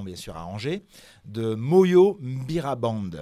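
ordinary delivery

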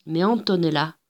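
usual speaking voice

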